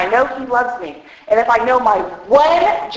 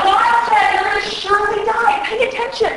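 mock warning; 9 semitones higher than usual. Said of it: "mocking God's warning".

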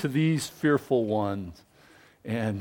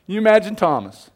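ordinary talk, nothing marked

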